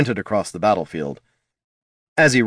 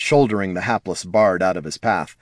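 usual speaking voice

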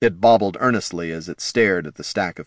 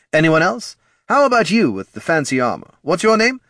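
high, loud, eager facilitator; pitched-up, punchy marketing boss; head of marketing, upbeat; 10 semitones higher, 5 dB louder than usual. Acted. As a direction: call on the group like a keen executive.

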